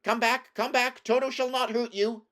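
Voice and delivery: loud, high-pitched call to runaways. A narrator's reading. Woodman calls to the fleeing mice, loud high calling. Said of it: "while he called to the mice".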